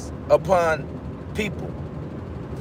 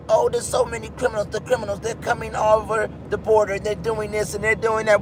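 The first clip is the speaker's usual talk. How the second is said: quick, softer run-on recital; fast mimicking of others' claims; mimicking alarmists; 5 dB quieter than usual. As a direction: rattle it off as mock hearsay. Mimics alarmist claims about criminals.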